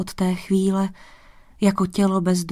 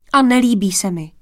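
quick, louder burst of refusal; resolute blurt; 5 dB louder, faster than usual. A narrator's reading. Daughter defying her father the king, resolute blurt. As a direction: blurt it out decisively. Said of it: "vyhrkla rozhodně".

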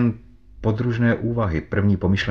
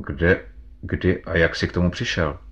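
ordinary delivery

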